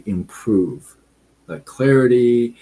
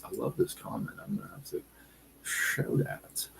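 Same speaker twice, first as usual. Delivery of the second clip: whispering an aside to herself; whispered aside; 10 semitones lower, 13 dB quieter, faster than usual. Real, not acted. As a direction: say it under your breath. Aside while reading live comments.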